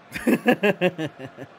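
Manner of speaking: short, loud burst of laughter; real laughing burst. Real, not acted. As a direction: laugh out loud briefly at your own remark. Laughing at his own remark.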